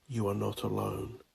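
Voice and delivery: ordinary delivery, nothing marked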